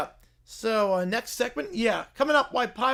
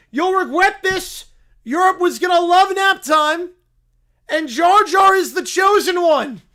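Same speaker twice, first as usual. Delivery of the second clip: loud, defiant bear; defiant bear preacher; 7 semitones higher, 10 dB louder than usual. Acted. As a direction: protest while being dragged out.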